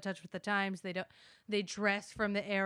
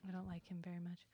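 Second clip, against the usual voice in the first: whisper; 2 semitones lower, 13 dB quieter, faster than usual. Real, not acted. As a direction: whisper it behind his back. Aside about the other speaker.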